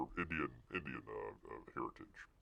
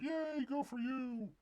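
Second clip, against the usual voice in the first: high, slow, mock-sweet cheer; mock cheerful lady; 8 dB quieter, slower than usual. Voicing the therapist in the photo, high slow mock cheer. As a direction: play the therapist as sugary cheerleader. The lady's like.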